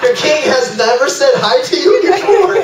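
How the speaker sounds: high-pitched, baffled teasing; hammed-up disbelief, voice jumping high; mock incredulous. Visitor to lady boy thrilled by the king, incredulous. Teller's incredulous reply, acted.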